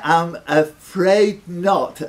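slow, mock-solemn I'm afraid not; drawn-out regret. Quizmaster drawling a mock-sorry no to a wrong answer.

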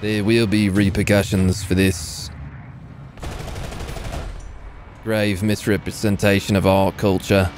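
mock-offended in a put-on Aussie-British accent; lightly hammed-up Brit-Australian accent; mixed Brit-Australian accent. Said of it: "new Brit-Australian accent".

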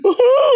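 long high mock sob; imitated crying wail. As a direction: howl like a man bursting into tears. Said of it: "he was crying all around".

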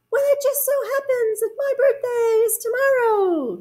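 very high, sing-out echo voice; echoing moon. A narrator's reading. Moon echoes bear's news back, high echo voice. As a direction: mimic it from far off, very high. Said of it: "said the moon".

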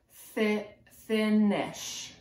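fi- then finish, said slowly and clearly; sounding out finish. Shown as a demonstration. Teacher helping kids read finish on the sign. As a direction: blend fi- into finish. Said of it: "on this sign it says".

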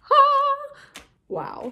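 delighted squeal. Real, not acted. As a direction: squeal with joy. Delighted shriek.

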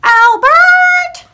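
very loud, high holler of a name; wife's yell. A narrator's reading. Wife rousing her husband from bed, yelling. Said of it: "the farmer's wife yelled".

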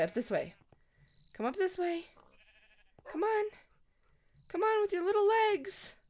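high sweet coaxing; coaxing puppy voice. Real, not acted. Coaxing a puppy up the steps, high and sweet. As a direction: lure the little dog up gently.

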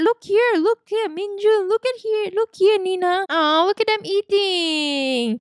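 high, eager photographer calling the couple; paparazzi calling out. Acted. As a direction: call for their attention like a camera pest.

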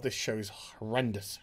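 low, quiet disgust; appalled undertone. Real, not acted. Reviewer appalled at the show, hushed disgust. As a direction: let it sink low in dismay.

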